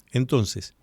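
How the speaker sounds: ordinary delivery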